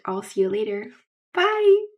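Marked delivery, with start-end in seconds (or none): 1.3-1.9 much higher, louder bright sign-off; high, loud excited bye; excited goodbye. Real, not acted. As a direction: burst out with a cheery farewell. Cheerful sign-off.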